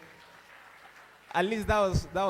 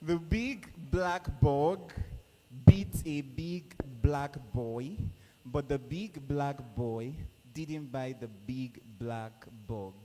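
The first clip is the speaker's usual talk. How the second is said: slow, careful tongue twister read; slow demo again; slower than usual. Shown as a demonstration. MC repeating the tongue twister slowly for contestants. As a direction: lay each word out slowly. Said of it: "I'll take that again".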